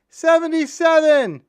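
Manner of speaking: high, loud and slow called-out number; old inmate hollering a joke number; shouted number. Retold or imitated. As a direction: stretch the number out as a big yell. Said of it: "jumped up and said".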